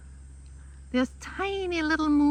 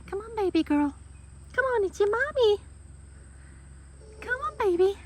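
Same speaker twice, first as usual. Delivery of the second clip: coaxing a pet; 6 semitones higher, 6 dB quieter than usual. Real, not acted. Coaxing shaking dog.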